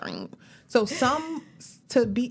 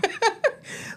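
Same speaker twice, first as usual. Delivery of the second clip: high, bright laugh; burst of laughter. Real, not acted. Laughs at a shared joke.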